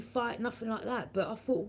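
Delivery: ordinary talk, nothing marked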